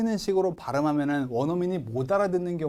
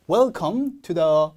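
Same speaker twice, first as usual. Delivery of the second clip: very slow "Welcome to the", left hanging; slow model reading; 3 dB louder, much slower than usual. Shown as a demonstration. Teacher modelling the opening, slow careful reading. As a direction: draw out "Welcome to the" word by word. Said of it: "한 단어씩 세게 읽어라".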